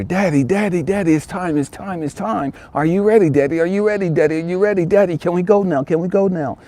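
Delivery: put-on high, pleading child's voice; eager little boy. Little boy begging his dad through the door, high and pleading. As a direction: keep pushing like an impatient kid. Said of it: "comes knocking on the door".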